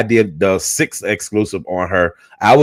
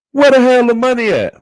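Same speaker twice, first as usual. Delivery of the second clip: much higher, louder mock husband yelling; angry yell; 13 semitones higher, 4 dB louder than usual. Husband storming in, yelling at Skillet about the money. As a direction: bark it like a man who wants his money now.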